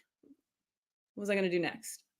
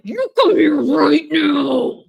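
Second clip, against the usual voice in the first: louder, slow and firm; stern command; 5 semitones higher, 7 dB louder, slower than usual. Shown as a demonstration. Teacher scolding the dog, firm and louder. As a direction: lay down the law slowly. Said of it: "No, it's like".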